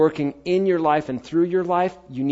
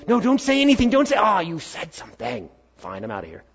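inner voice of doubt; 5 semitones higher than usual. Retold or imitated. Preacher mimics his own doubting inner voice, panicked then sulking. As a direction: play the fearful inner voice, then let it stomp off. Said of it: "That voice that goes".

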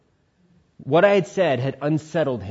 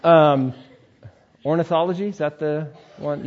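normal talking voice

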